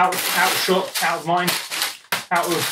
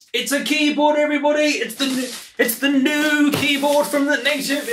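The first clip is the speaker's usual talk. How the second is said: excited reveal; 7 semitones higher than usual. Real, not acted. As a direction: stretch it out high for everybody. Revealing the new keyboard.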